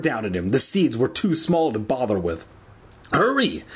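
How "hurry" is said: higher, urgent plea; mildly acted pleading cry. A narrator's reading. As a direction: urge them on with a swooping pitch. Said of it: "Evan pleaded".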